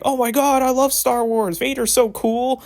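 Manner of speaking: high, loud squealing fangirl; gushing fan voice. Retold or imitated. Mocking fans who gush over a Darth Vader shirt, squealing high and loud. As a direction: squeal it like a starstruck Star Wars fan. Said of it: "they're like".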